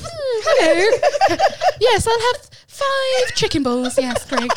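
phone voice. Retold or imitated. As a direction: put on an exaggerated phone-order voice. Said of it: "putting on that phone voice like".

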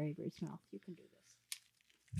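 whisper. Acted. Coaching her own mouth.

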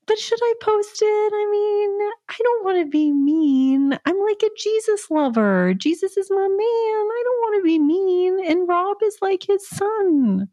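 high, sweet, mock-worried; innocent fretting. Asking husband whether to post, high mock-innocent worry. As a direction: fret like a sweet church girl. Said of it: "then I said".

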